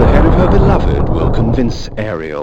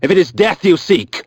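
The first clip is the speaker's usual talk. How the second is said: higher, louder contemptuous challenge; cold threat; 4 semitones higher, 4 dB louder than usual. Acted. The vampire Kain accepting the old mentalist's challenge, menacing.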